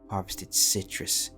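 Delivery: plain reading voice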